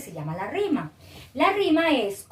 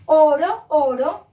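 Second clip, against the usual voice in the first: "Oro, oro" punched out word by word; first "Oro" loud, then "oro" echoing it; stressed rhyme endings; 2 semitones higher, 7 dB louder, slower than usual. Shown as a demonstration. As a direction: show the matching "oro" endings. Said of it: "Y si miramos, es lo mismo".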